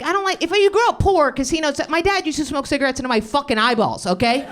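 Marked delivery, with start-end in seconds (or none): 0.0-1.8 worked-up retort. Retold or imitated. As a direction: fire it back, higher and hotter. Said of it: "And I'm like".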